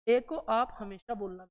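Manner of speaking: normal talking voice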